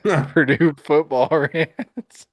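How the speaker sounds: laughing through the words, teasing; laughing tease. Real, not acted. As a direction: chuckle while naming his friend's habit. Teasing co-host about his rants.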